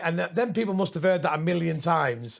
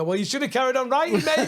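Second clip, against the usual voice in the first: mock heckle; 8 semitones higher, 3 dB louder than usual. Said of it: "I go".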